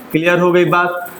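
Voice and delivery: ordinary delivery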